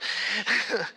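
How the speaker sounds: a quick amused chuckle at her own joke; light laugh. Real, not acted.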